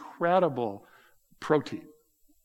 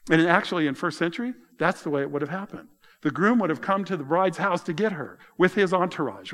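usual speaking voice

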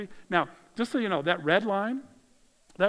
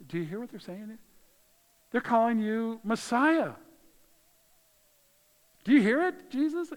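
rising, louder, higher mocking challenge; indignant leaders; 6 semitones higher, 3 dB louder than usual. Chief priests confronting Jesus in the temple, mocking challenge. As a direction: needle him, pitch climbing to the end. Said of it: "And Jesus".

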